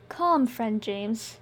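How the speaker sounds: slow, soothing woman's voice; calm him down gently and slowly. Acted. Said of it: "Ely said soothingly".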